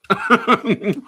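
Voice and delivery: high, loud burst of laughing; short laugh. Real, not acted. Host done arguing with caller, laughing it off. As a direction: let out a quick, loud laugh. Laughs after dropping the argument.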